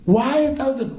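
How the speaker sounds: very high, startled question; incredulous. Acted. Women hear the pot has holes, incredulous. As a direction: squeak out the question in disbelief.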